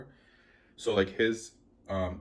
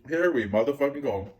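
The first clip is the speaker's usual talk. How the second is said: higher, louder, psyched-up exclamation; hyped outburst; 7 semitones higher, 8 dB louder than usual. Retold or imitated. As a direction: pump yourself up for the worst. Bracing for a shootout.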